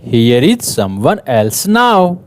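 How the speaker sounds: loud, lively classroom read-aloud; teacher reading a picture story aloud to young pupils; reading to children. Acted. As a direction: project the story to the whole class.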